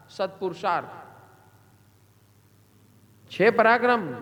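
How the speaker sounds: normal talking voice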